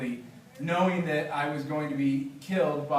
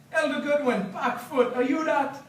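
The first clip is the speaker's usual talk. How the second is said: much higher, louder, joyful Jamaican greeting; delighted Biga; 10 semitones higher, 4 dB louder than usual. Acted. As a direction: call out to an old friend in surprise. Biga greets Gideon, read aloud.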